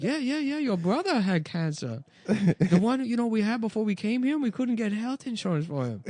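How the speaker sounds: imitating mom, high and chattering. Comedian voicing his mom talking about his cancer brother.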